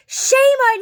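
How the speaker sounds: high, loud imitation of outrage; exaggerated, high-pitched scolding shout; mock scolding yell. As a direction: holler it like an indignant neighbor. Retelling neighbor's scolding.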